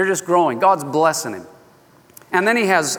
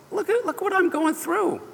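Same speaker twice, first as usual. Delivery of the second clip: high, soft, desperate plea; pleading prayer; 12 semitones higher, 5 dB quieter than usual. Retold or imitated. Jacob pleading with the Lord, high and soft. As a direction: cry out to God for help.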